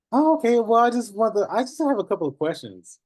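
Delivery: high-pitched caller, caught off guard; imitated surprised caller. Caller answers a laughing notary, imitated high, surprised voice.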